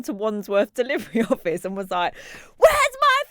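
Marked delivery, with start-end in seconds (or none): 2.6-3.2 very high, loud, drawn-out pretend fury; mock angry demand. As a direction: bellow it as a hammed-up furious customer. Imagining raging at the delivery office.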